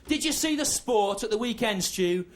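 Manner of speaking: mock hearty schoolmate. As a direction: mimic a chirpy English schoolboy lad. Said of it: "people would go".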